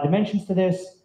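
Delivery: ordinary delivery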